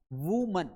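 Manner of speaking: "वुमन" said WOO-mun; singular pronunciation. Shown as a demonstration. Teacher showing the word for one lady, singular pronunciation. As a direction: give "वुमन" an oo in the first syllable. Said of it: "क्या पढ़ा जाता है".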